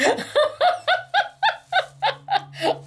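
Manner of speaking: long, high-pitched burst of laughter; real high giggling fit; big laugh. Real, not acted. Laughing over keeping the candy.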